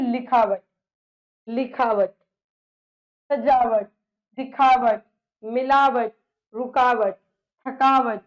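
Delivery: teacher stressing the suffix आवट; emphatic word list. Shown as a demonstration. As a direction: recite the examples loudly. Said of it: "प्रत्यय है आवट".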